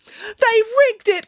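loud, high, drawn-out protest; accusing cry. A narrator's reading. Matilda leaps up crying foul, loud accusing cry. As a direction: cry foul at the top of your voice. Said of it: "cried Matilda".

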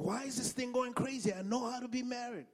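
high-pitched, exasperated questioning; bewildered protest. Retold or imitated. Newlywed wondering why marriage goes crazy, bewildered protest. Retelling his bewildered thought back then.

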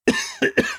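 sudden choking cough. Real, not acted. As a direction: cough into the mic. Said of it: "I'm choking a little bit".

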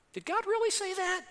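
high-pitched, questioning mock voice; doubting culture. Preacher mimics culture echoing Satan's question, in a high mock-doubting voice. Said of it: "Our culture's still doing that today".

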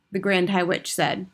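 plain reading voice

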